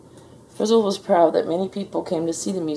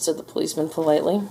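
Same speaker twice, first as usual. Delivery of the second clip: ordinary delivery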